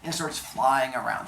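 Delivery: ordinary delivery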